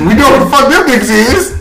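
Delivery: high, loud, fast burst through laughter; squealing with laughter; laughing outburst. Real, not acted. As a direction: crack up while shouting it out. Laughing at known platform.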